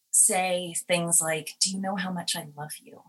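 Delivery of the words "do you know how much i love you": soft, fast and tender. Retold or imitated. Director kneeling to a child before a scene, tender. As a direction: gently reassure a child actor. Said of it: "come down to your level and say".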